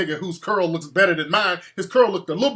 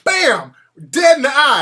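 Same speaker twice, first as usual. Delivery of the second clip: punch shout; 5 semitones higher, 6 dB louder than usual. Retold or imitated. Retelling landing a punch.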